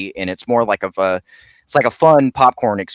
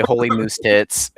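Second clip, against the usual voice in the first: mock Mountie exclamation. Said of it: "in unison, say".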